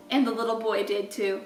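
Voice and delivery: plain reading voice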